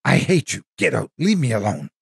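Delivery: high yelled outburst at the ghost; screaming. Retold or imitated. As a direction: shout it up high, a teen lashing out. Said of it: "Sometimes you screamed".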